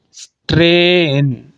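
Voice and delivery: स्ट्रेन very slow, as "stre... en"; diphthong split. Shown as a demonstration. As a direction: stretch the first vowel of स्ट्रेन, then glide to "en". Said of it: "एक vowel से मैं दूसरे vowel की तरफ गया". (स्ट्रेन को खींचकर "स्ट्रे... इन" बोलें)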